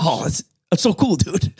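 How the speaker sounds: lightly voiced laid-back Shane Gillis; a hint of Shane Gillis drawling it out; Shane Gillis impression. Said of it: "That's my Shane Gillis impression".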